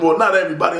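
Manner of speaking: ordinary talk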